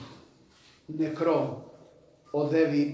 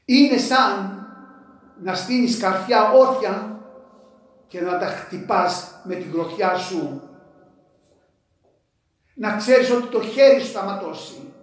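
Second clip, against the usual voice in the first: preaching at full force; 4 semitones higher, 7 dB louder than usual. Real, not acted.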